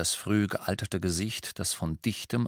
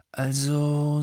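long drawn-out word, held higher; slow; 6 semitones higher, 3 dB louder than usual. A narrator's reading. Harry starting to disagree with Lupin, slowly. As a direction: stretch the word out as if weighing it. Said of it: "sagte Harry langsam".

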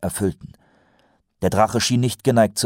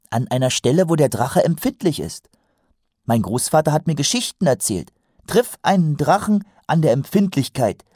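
higher, keen storytelling recruit; earnest young watchman, eager; 8 semitones higher, 4 dB louder than usual. A narrator's reading. Recruit quotes grandfather's dragon tales, eager and high. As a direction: pass on the lore with naive conviction.

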